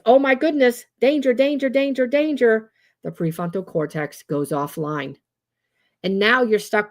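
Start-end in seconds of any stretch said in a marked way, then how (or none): 0.1-2.7 high, repeating mock alarm; amygdala alarm. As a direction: play a panicking brain raising the alarm. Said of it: "your amygdala says".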